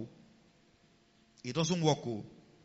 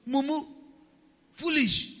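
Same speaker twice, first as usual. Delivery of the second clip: rising higher, louder insults; mock angry insults; 8 semitones higher, 3 dB louder, slower than usual. Preacher imitating an angry partner insulting, mock anger. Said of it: "never start saying".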